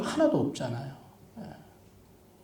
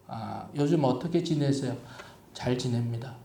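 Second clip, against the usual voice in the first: curt small talk; 6 semitones lower, 9 dB quieter than usual. Shown as a demonstration. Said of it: "요렇게 얘기하지 마시고".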